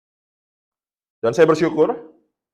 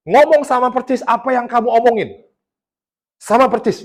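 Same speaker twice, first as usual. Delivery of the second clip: high-pitched, emphatic quoted amazement; retold astonishment; 9 semitones higher than usual. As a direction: voice her amazement, pitched well up. Retelling a stunned woman's testimony. (menirukan rasa takjub dengan nada tinggi)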